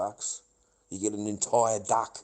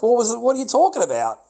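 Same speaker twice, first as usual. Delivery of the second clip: loud, high-pitched questioning; acted exasperated demand; 11 semitones higher, 12 dB louder, faster than usual. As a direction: re-enact frantic pressing for an answer.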